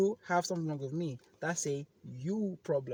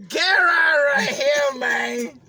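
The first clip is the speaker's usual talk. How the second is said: hype shout; 10 semitones higher, 16 dB louder, much slower than usual. Retold or imitated. Hyping himself up.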